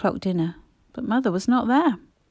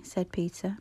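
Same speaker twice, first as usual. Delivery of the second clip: usual speaking voice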